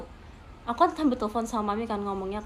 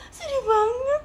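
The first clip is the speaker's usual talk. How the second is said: high, tearful whimper imitating a kid; imitating crying sister; 11 semitones higher, much slower than usual. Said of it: "Terus dia bilang".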